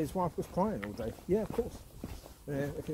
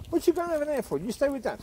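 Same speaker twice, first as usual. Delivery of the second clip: calling the dog; 10 semitones higher than usual. Real, not acted. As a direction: tell him off lightly. Scolding dog fondly.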